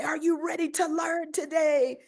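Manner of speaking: high, upbeat question to the class; rally the kids for learning; cheery teacher call. Retold or imitated. Principal calling to her students each morning, high bright rally. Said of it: "And then I'll say".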